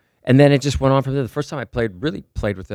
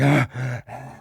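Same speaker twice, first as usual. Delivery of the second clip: winded panting, acted out; out of breath. Drummer bursts in backstage after fleeing screaming girls, out of breath. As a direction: gasp for air as if just off a sprint. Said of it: "you know, out of breath".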